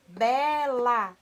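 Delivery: "Bela" slow and loud, in two syllables; separando as sílabas. Shown as a demonstration. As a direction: stretch "Bela" into be-la, each syllable clear. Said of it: "quantas vezes nós abrimos a boquinha". ("Bela" forte e devagar, em duas sílabas)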